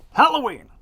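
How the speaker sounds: usual speaking voice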